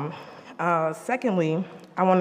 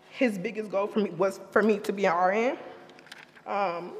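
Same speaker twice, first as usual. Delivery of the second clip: ordinary talk, nothing marked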